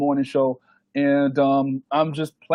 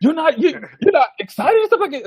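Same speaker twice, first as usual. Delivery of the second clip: high, pushy disbelief; amazed friend; 9 semitones higher, 3 dB louder than usual. Retold or imitated. Friend Khalad pestering Fame, high and worked up. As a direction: press him, pitched up and loud.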